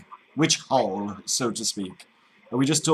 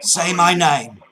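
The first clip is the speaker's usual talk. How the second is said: loud, higher, slow Cockney order; demanding; 5 semitones higher, 8 dB louder, slower than usual. Pretend. Cockney caller ordering the agent, angry and slow. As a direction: demand it word by word.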